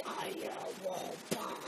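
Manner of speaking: slow, low, whispered robot monotone. Retold or imitated. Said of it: "it was hearing like this".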